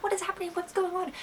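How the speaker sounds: high, very soft demand to know; hushed urgent questioning. Retold or imitated. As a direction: keep it tiny and high, pressing for news. Hushed questions outside the boys' door.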